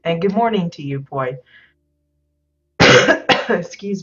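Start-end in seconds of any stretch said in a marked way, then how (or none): 2.8-3.4 cough. Real, not acted. Cough mid-stream.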